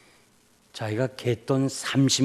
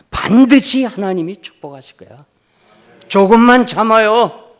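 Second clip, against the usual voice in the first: fervent comfort; 9 semitones higher, 14 dB louder than usual. Retold or imitated. Pastor comforting the beaten deaconess, fervent high plea. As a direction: urge her to hold on with passion.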